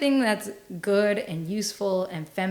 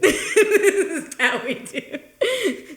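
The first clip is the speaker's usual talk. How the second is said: higher, slow, stretched out through laughter; laughing; 8 semitones higher, much slower than usual. Real, not acted. Echoing her cohost after the batteries died, laughing. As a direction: echo the line while cracking up.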